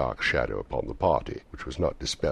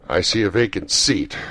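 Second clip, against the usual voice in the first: loud, raised voice of a spirit; prophesying ghost; 5 semitones higher, 9 dB louder than usual. A narrator's reading. Ghost foretells the child's death.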